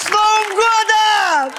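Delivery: slow, drawn-out holiday yell; festive shout. Acted. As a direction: belt out the greeting as you barge in. Drunk friend bursts in celebrating.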